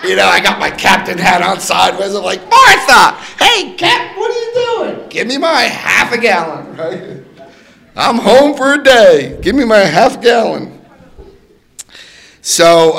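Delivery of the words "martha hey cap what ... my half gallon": put-on much higher and louder, slow drunk calling out; hammed-up hammered customer; drunken yell. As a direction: drunk in a captain hat hollering at the drive-through. Drunk calling out at liquor window.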